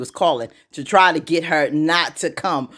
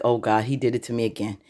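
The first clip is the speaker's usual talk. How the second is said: low, quick, soft aside; muttered dismay; 7 semitones lower, 6 dB quieter, faster than usual. Retold or imitated. Andy realizing he did it again, low dismayed mutter. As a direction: mutter it low under your breath. Retold dismayed reaction, first take.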